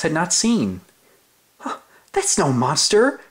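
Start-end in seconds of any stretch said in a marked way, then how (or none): 1.6-3.2 discovering cry. Acted. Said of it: "cried Oscar".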